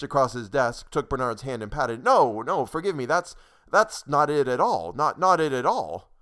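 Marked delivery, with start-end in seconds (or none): 2.0-6.1 loud, slow, insistent apology; frantic apology. Acted. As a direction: plead that he was misunderstood. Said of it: "Mister Cummings' frantic apologies".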